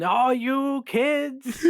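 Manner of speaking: long drawn-out holler, high and loud; high, slow scolding yell. Acted. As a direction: yell it out at the kids, stretched way out. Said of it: "I start yelling".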